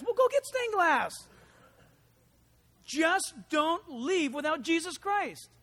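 ordinary talk, nothing marked

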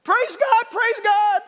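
high, loud tearful cry; high loud tearful praise. Retold or imitated. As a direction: translate her sob, high and loud. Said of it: "she's crying".

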